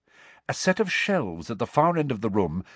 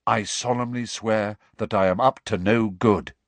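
ordinary delivery, nothing marked

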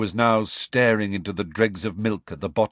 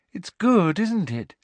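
higher, bright pleased Luna; happily; 10 semitones higher than usual. A narrator's reading. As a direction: show off the hat with glee. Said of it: "said Luna happily".

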